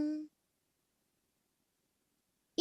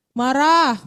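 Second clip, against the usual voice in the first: loud marah; 6 dB louder than usual. Shown as a demonstration. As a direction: bark marah out. (marah diteriakkan keras)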